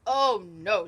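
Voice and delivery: loud, low oh no; stern dad's refusal; firm father refusing. A narrator's reading. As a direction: put your foot down, low and loud. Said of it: "said her father".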